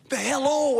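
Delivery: high, loud exclamation; excited wife. Retold or imitated. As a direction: blurt it out, thrilled. Wife excitedly telling him news.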